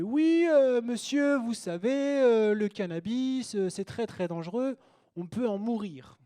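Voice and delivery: high, drawn-out mock official voice; put-on high voice, stretching out the warning; small customs officer. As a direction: lecture slowly as a little customs man.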